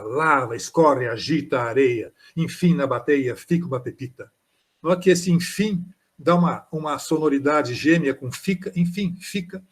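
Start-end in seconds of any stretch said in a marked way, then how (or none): 0.0-4.3 poem recital. Shown as a demonstration. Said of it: "quantas vogais e consoantes misturadas há?".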